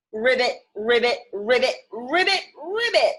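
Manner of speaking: playful froggy ribbits, rising at the end; croaking frog. Acted. Host croaking like Piggy the frog for the kids. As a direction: croak them out like a happy frog.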